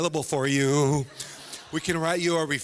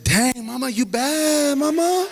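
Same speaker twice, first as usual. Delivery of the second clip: impressed son; 7 semitones higher, 9 dB louder, much slower than usual. Retold or imitated. Son admiring mom's toughness.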